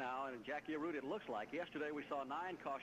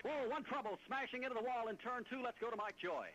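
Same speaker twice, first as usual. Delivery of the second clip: excited; 11 semitones higher than usual. Real, not acted. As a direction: react to a sudden wreck. Sudden crash on track.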